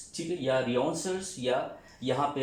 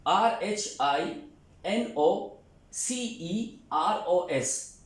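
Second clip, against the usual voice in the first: slow spelling out; 4 semitones higher, much slower than usual. Shown as a demonstration. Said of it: "गेंडे को कहते हैं आर एच".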